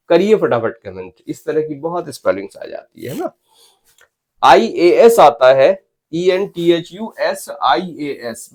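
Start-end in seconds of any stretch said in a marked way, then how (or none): 4.4-5.2 IAS said loud and drawn out with a rise; stress on IAS. Shown as a demonstration. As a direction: hit IAS hard, slowly. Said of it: "बस इस पर stress दीजिए". (IAS पर ज़ोर देकर, खींचकर)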